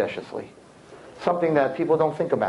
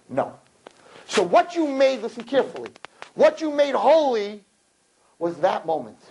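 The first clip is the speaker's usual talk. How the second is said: usual speaking voice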